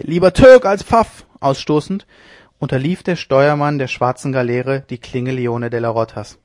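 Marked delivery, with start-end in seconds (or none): 0.0-1.2 much higher, louder, slow war shout; wild battle cry. Acted. Jan Norris charging the captain, wild battle cry. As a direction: hurl the rebels' battle cry. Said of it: "Den wilden Geusenschrei".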